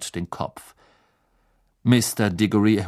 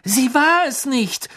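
put-on higher, slow firm girl; 5 dB louder, slower than usual. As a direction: state it firmly as a girl. Hermine voiced.

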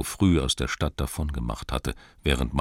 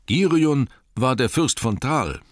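the Master cuts in, drawing himself up; Master's objection; 8 semitones higher, 5 dB louder than usual. Acted. Master of Lake-town rebuts the crowd, higher pompous voice. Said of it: "sagte er".

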